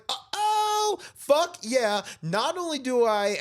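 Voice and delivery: high-pitched celebratory whoop; triumphant. Retold or imitated. Retold triumph at cancer-free news.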